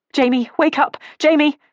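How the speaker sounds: loud, high urgent shouting. A narrator's reading. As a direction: yell to wake her. Waking friend in a fire.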